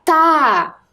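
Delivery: "Tá" alone, held long and open; isolated first sound. Shown as a demonstration. Teacher showing the first sound of tapete, "Tá" held. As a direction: sound out "Tá" as one long syllable. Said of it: "primeiro som que você escuta quando eu abro a boca".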